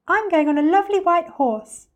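put-on high, loud delighted child; thrilled high-pitched child's call; excited cry. A narrator's reading. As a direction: cry out eagerly for your ride. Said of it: "cried another".

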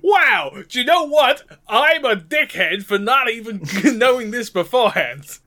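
hammed-up grovelling to the boss; increased sucking up. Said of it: "increase your sucking up".

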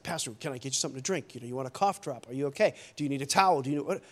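low, hushed, rapid offers of help; attentive church host. Retold or imitated. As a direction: imitate an over-attentive helper, low and quick.

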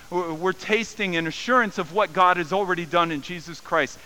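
normal talking voice

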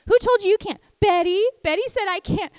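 put-on young son puzzled by his sister, high and earnest; sweet little boy. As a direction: sound pure-hearted and confused like a small child. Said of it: "he could be like".

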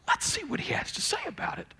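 soft conspiratorial whisper, a little quick; hushed fast whisper. Acted. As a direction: lean in and whisper the teaser. Aside before reading Jesus' words.

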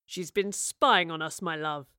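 slow, lightly growled accusation; touch of menace. A narrator's reading. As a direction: growl it slowly to a loved one. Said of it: "Rivers growled".

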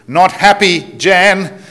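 a mild mock yell in broad Australian; boss yelling. Boss yelling out the window at Jan, mock Australian yell. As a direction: call it after Jan in a broad Australian accent. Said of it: "the boss yells out the window".

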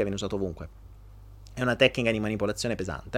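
ordinary talk, nothing marked

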